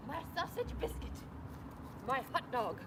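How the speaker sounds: cooing pet talk. Real, not acted. Cooing at the dog.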